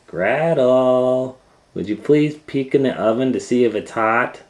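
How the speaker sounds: high, loud coaxing call; sugary witch call; falsely sweet witch. Acted. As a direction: lure her toward the oven. Witch lures Gretel sweetly.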